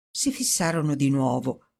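ordinary delivery, nothing marked

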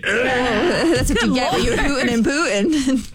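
slow, laughing through the words. Real, not acted. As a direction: giggle while saying it. Laughing at the joke.

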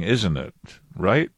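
normal talking voice

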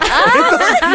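very high burst of real laughter; laughs. Real, not acted. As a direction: crack up at the punchline. Laughs at the reversal.

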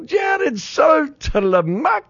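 high, very slow drawn-out mock British call; stretched-out English commentator. Radio host mocking English play-by-play, high and drawn out. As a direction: stretch every syllable like a Wimbledon announcer. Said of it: "they would stretch it out like".

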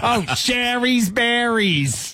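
high, slow, stretched sponsor plug; mock ad-read impression. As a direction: sing out the brand name slowly. Listener offers a comic impression.

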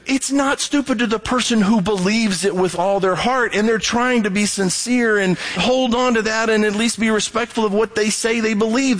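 usual speaking voice

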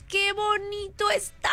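high, slow gushing delight. Real, not acted. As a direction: coo over the cute sticker. Delighted by a fan's sticker. (encantada, alargando "¡Qué bonito está!")

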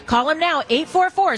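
usual speaking voice

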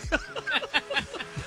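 high shrieking cackle; cackling laugh. Real, not acted. Host cackling after roasting [name] to co-hosts. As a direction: let out a big cackle.

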